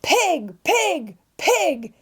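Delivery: high-pitched enraged yell; shouting in rage. A narrator's reading. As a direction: cry it out furiously, each word a separate high burst. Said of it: "at the top of his lungs".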